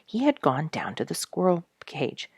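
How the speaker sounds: ordinary delivery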